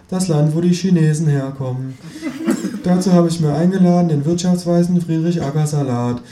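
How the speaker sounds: high, chirpy TV host voice; talk show host. Acted. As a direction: present the guests brightly. TV host presents guests.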